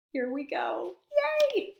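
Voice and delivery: plain reading voice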